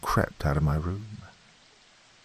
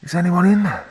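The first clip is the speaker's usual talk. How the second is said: high, louder desperate call; frightened call; 13 semitones higher, 5 dB louder than usual. A narrator's reading. Woman begging anyone inside to hear, frightened. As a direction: plead through the door. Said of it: "The voice was muffled, frightened".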